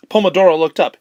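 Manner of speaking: plain reading voice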